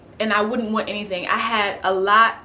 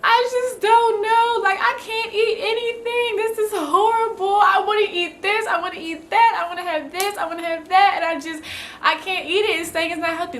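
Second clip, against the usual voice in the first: high, tearful, breaking voice, much higher than usual; retold late-night crying fit; crying on the phone; 9 semitones higher than usual. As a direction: sob out the words as if pleading with mom. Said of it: "I literally busted out in tears".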